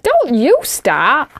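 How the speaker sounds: slow drawn-out mock warning. Mum warning the dad not to join in, mock stern. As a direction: warn him off, half joking. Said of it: "I was like".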